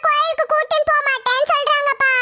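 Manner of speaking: slow, wailing complaint to dad; drawn-out, tattling boo-hoo; crying child. Acted. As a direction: whine tearfully like a small child. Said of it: "இப்ப எதுக்கு அழுகுற?".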